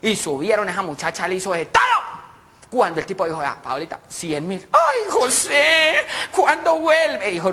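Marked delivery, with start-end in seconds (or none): 3.4-4.7 low, soft murmur; discreet payer. Acted. José pays Paolita afterwards, quiet and discreet. 4.7-7.3 high, drawn-out coo; delighted woman. Acted. Paola wants José to return, delighted.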